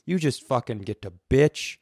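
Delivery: normal talking voice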